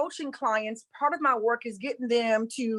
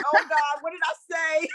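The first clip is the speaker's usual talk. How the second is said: quick, jittery mock panic; mock nervous; 3 semitones higher than usual. Guest recalling her panic, mock nervous and rushed. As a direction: rush it like a startled worrier. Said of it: "I was getting nervous 'cause I was like".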